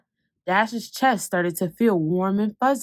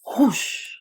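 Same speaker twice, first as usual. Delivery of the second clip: blowing whoosh; 3 semitones higher than usual. Acted. Blowing out candles.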